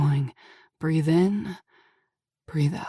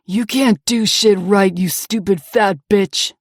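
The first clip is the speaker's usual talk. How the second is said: slow, loud, cruel yell; hammed-up abusive yell; yelling abuser; 7 dB louder than usual. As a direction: spit the words at her slowly. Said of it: "Brady yells".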